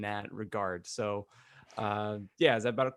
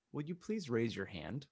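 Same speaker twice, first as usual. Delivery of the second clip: ordinary talk, nothing marked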